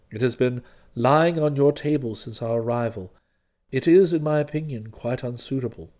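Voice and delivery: very low, measured deferential voice; unruffled valet. Acted. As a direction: explain with calm, formal dignity. Jeeves goes on undeterred.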